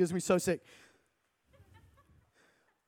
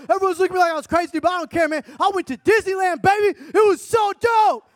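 much higher and louder, bursting with Disneyland hype; hyped-up friend; 9 semitones higher, 4 dB louder than usual. Acted. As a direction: gush like a kid just back from the park. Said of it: "so hype on Disneyland".

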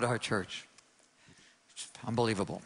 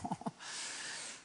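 laugh; 13 dB quieter than usual. Real, not acted. Laughs at own newspaper story.

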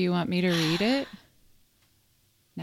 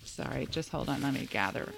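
soft, choked-up voice, fighting tears; holding back tears; 4 semitones lower, 9 dB quieter than usual. Real, not acted. Host reading a grief letter, softly trying not to cry. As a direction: quietly pull yourself together through the tears. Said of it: "I'm trying not to cry".

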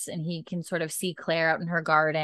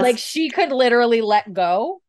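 higher, louder through laughter; laughing; 5 semitones higher, 6 dB louder than usual. Real, not acted. Host laughing about the kitten clinging on. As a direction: crack up while describing it.

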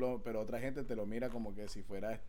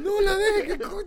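laughing; 10 dB louder than usual. Real, not acted. Laughing plea about mom listening.

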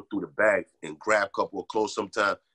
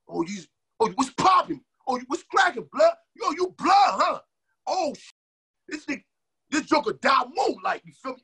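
high, loud mocking street taunt, pitched way up; aggressive street challenge; 4 dB louder than usual. Gang kids on the bus challenging a new kid, high mocking shout. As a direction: bark it like a gang kid sizing up a stranger.

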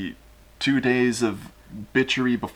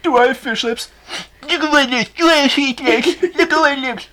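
much higher and louder, squeaky; 7 dB louder than usual. Acted. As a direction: squeak it out in panic. Ceausescu voiced high, panicked.

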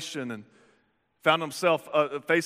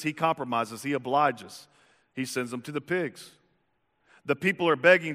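normal talking voice

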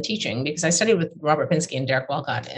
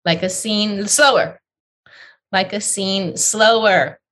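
calling out slower, higher and louder; impatient teacher calling slower; mock recitation coaching; 5 semitones higher, 4 dB louder, slower than usual. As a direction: push the reciter to slow down. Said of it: "Slow, slower".

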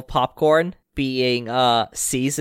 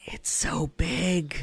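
put-on awed child whisper; 3 semitones higher, 7 dB quieter, slower than usual. Child awed by big plush cat.

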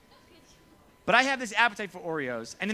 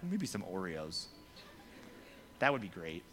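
sheepish craving; 11 semitones lower, 10 dB quieter than usual. Acted. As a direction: murmur it as a tempting afterthought. Acts out sneaky later craving.

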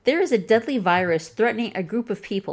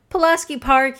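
higher and louder, delighted; proud hometown shout-out; beaming; 6 semitones higher, 4 dB louder than usual. Retold or imitated. Man proudly telling her his neighborhood, beaming. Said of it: "he beamed".

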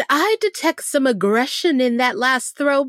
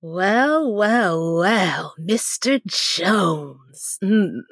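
slow drawn-out tease, sinking lower; teasing, impressed; 6 semitones lower, much slower than usual. Acted. Teasing Bradley over the photos, slow and suggestive. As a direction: stretch each word, dropping in pitch.

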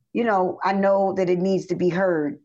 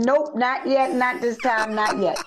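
voicing God; 6 semitones higher than usual. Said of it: "He said".

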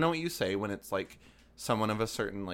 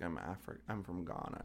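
quiet; 4 semitones lower, 9 dB quieter than usual. Retold or imitated. Quoted softly, dropping level.

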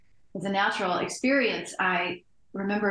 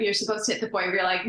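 ordinary delivery

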